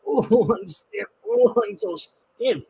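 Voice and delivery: high, slow stiff little groan; groaning engine. Acted. Henry the dirty engine groaning as he starts out, high slow groan. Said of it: "he groaned".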